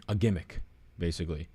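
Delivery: usual speaking voice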